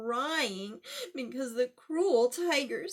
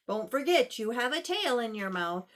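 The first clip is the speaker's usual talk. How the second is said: ordinary delivery, nothing marked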